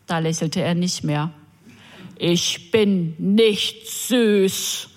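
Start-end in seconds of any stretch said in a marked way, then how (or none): 2.2-4.9 very slow, every word pushed out separately; each word forced out. Retold or imitated. Offended man at the bus stop, each word bitten off. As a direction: spit out "Ich bin nicht süß" word by word. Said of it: "jedes Wort einzeln hervorstieß".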